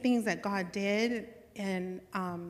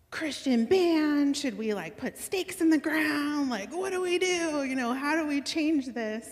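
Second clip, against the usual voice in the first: higher, louder, building questions; eager questioning; 7 semitones higher, 4 dB louder than usual. Retold or imitated. Retold eager questions to God.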